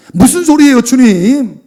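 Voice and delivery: high, loud mock protest. Acting out Peter's protest to Jesus.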